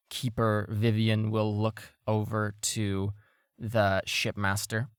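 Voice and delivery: ordinary delivery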